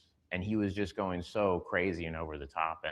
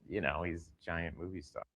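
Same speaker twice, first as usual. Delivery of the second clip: normal talking voice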